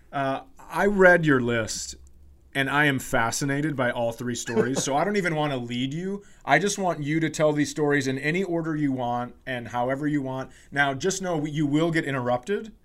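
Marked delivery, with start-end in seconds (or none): none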